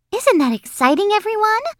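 high, squealing schoolgirl question; very high, rising, eager girl; excited show-off girl. Acted. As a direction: gush and fish for agreement.

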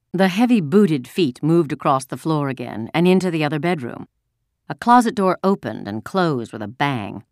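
ordinary delivery

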